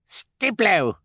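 put-on very high baby cry; baby Sunny. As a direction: squeal it like a baby. Sunny cries.

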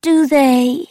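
very high, loud, eerie taunt; mocking wraith. A narrator's reading. Wraith doubts the narrator's boast, high and mocking.

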